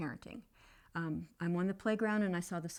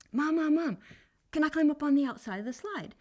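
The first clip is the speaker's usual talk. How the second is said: put-on higher, louder little boy's call; child calling mom; 7 semitones higher, 8 dB louder than usual. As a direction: call out to mom like a four-year-old. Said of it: "would sound like this".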